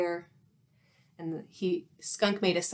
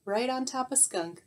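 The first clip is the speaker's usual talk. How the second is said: plain reading voice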